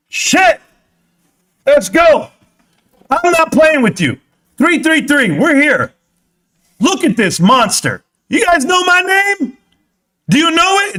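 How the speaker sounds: pumped up, shout it out as the price climbs; excited yelling. Real, not acted. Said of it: "Hi, I don't mean to yell".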